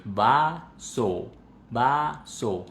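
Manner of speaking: "vaso" drawn out syllable by syllable; exaggerated articulation. Shown as a demonstration. Teacher telling learners to exaggerate, over-articulated "vaso". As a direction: split "vaso" into two long, fully opened syllables. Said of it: "articulen los sonidos".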